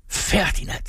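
high, breathy cry of surprise; cried out. A narrator's reading. Morten sees his brother, a high astonished cry. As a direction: gasp the name, hardly believing it. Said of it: "råbte Morten".

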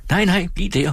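high-pitched call without real volume; mild narrated call. A narrator's reading. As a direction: call out lightly, pitch well up. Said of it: "råbte hun". (let, lyst tilråb)

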